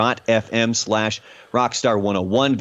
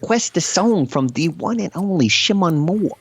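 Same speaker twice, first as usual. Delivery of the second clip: higher, hammed-up British accent; put-on British accent; 6 semitones higher than usual. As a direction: keep the fake British accent going on the plugs. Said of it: "gone from British to Australian".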